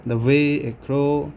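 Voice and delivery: very slow, with "way" and "crow" stressed and drawn out; light "The" and "a", heavy beat on "way" and "crow"; iambic stress. Shown as a demonstration. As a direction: demonstrate the iamb, leaning on "way" and "crow". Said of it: "stress পড়ছে না, এটাতে পড়ছে".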